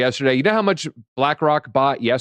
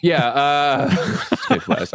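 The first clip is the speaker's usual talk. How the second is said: laughing; slower than usual. Real, not acted. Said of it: "laughing while saying Midwest".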